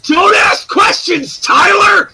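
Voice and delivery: full-throated yell, drawn out. Acted. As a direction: scream it at the internet. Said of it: "He said he was gonna yell and he delivered".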